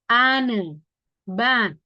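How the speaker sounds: "ane", "ban" with long A, silent H; lengthened vowel. Shown as a demonstration. Teacher giving silent-H examples, drawn-out A. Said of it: "lebih panjang huruf vokalnya".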